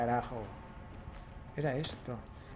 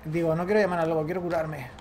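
normal talking voice

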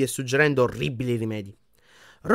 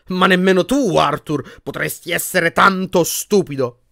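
raised, loud exasperated rebuke; angry scolding; 5 semitones higher, 6 dB louder, slower than usual. A narrator's reading. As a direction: scold a husband in disbelief. Wife scolds her foolish husband.

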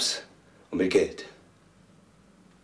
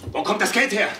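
sharp question; 10 semitones higher, 3 dB louder than usual. Acted. Son confronts drunk father.

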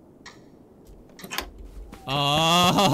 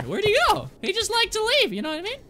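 much higher silly voice; squeaky voice; 14 semitones higher, 3 dB quieter than usual. Pretend. Gamer voicing the missing plushie, squeaky high. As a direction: pitch it way up like a toy.

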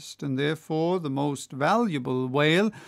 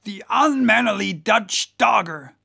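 loud, higher-pitched insult; indignant cry; 4 semitones higher, 5 dB louder than usual. Acted. Whaler at the German who threw his oil can, indignant cry. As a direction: hurl abuse at the rival boat. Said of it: "cried Stubb".

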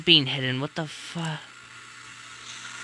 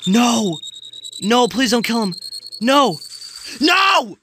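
loud, high panicked cries; desperate yelling at the screen; horrified pleading; 9 semitones higher, 4 dB louder than usual. Real, not acted. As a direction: beg at a shout, climbing higher. Horrified, pleading for a character's life.

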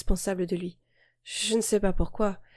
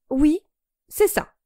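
defiant; 8 semitones higher, 5 dB louder, slower than usual. Acted. Defiant reply in a quarrel.

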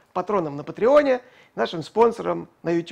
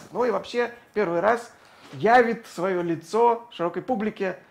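ordinary delivery

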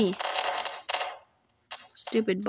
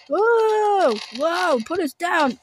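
startled whoa; 7 semitones higher, much slower than usual. Acted. Lifted by powers, protesting.

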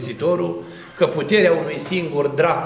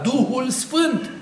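high, slow ringing cry; emphatic proclamation; 7 semitones higher, slower than usual. Real, not acted. Preacher to congregation, naming the true Spirit in a slow, high proclaiming cry. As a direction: proclaim it like a revelation.

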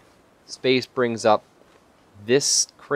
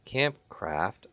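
normal talking voice

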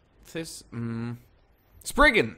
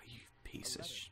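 whisper; 21 dB quieter than usual. Real, not acted. Angry at game enemy.

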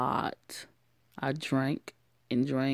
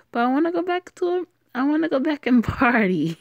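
put-on much higher, fast, loud drunken pleading with a laugh; drunk and whiny; 10 semitones higher, 5 dB louder, faster than usual. Drunk girl begging Alex to let her party, giddy high whine.

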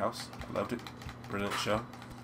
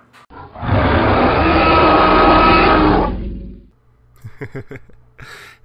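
very loud, high drawn-out scream; long scream; 19 dB louder than usual. Real, not acted. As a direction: scream at the top of the voice. Long outburst at the game.

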